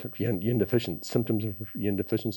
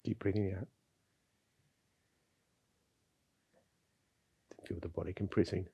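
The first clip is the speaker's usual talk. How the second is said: soft, calm breathing instruction; guided breathing; 5 dB quieter than usual. Acted. Guides breathing exercise, soft and slow.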